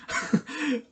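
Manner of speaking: very high short laugh; high giggle. Real, not acted. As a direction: squeak a quick laugh. Laughing at rappers.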